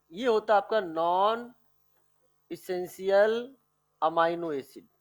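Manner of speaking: term broken up with pauses for note-takers; slow, chunk-by-chunk dictation. Shown as a demonstration. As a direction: dictate it slowly, pausing before essential and amino. Repeats it for note-taking.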